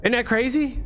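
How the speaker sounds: ordinary delivery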